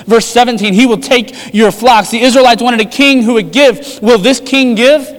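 rising preaching force. Real, not acted. Building list of takes.

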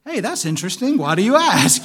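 high, loud fake-cheerful reply; sarcastic chirpy retort he never said; mock polite reply. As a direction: sing it out like a breezy brush-off. Ironic polite reply he never gave.

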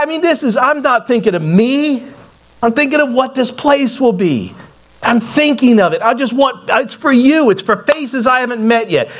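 high, impassioned plea; desperate pleading. Retold or imitated. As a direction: plead your case with rising urgency. Retold desperate plea for the property.

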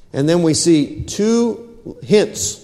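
ordinary talk

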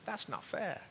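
put-on "That's not fair," whined up high like a small boy; little boy complaining. Pastor imitating his young son's complaint, high and whiny. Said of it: "My son used to say that a lot".

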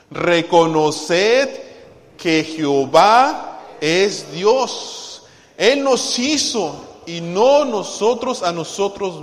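loud, very slow declamation; leading scripture reading. Real, not acted. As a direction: stretch each phrase for the congregation. Leading congregation in reading psalm.

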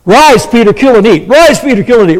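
loud, high repeated command; God commanding. Retold or imitated. Preacher voices God commanding Peter, loud. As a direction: call it out with force. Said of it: "Three times God says that".

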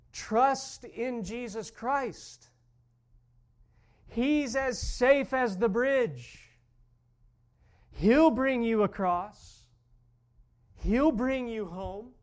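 loud, slow, pleading preacher; earnest plea. Retold or imitated. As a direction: beg her slowly to trust. Pastor pleading with the old lady.

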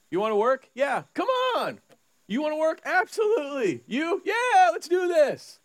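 high, excited back-and-forth; playful owner and workers hyping up; eager hiring banter. Acted. Acting out eager hiring exchange.

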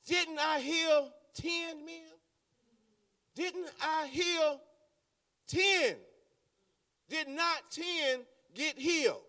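Jesus asking. Acted. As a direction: ask it slowly, wounded. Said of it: "Jesus asks".

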